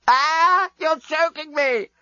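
very high, slow, strangled scream. A narrator's reading. Strangled accountant screaming at Leovinus, very high. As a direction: squeal it out while choking. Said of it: "screamed Scraliontis".

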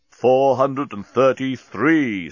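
very slow, deliberate countdown; counting bomb. A narrator's reading. Bomb refusing interruption, counting slowly. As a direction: count out each word steadily.

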